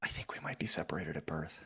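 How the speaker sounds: hushed joking whisper. Real, not acted. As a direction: whisper it like a secret. Host jokes in a whisper.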